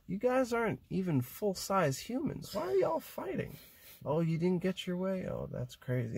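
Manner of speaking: put-on high, sarcastic scolding of kids; mock sarcastic scolding. To schoolkids who fight, a mocking high scold. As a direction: talk down to the children mockingly.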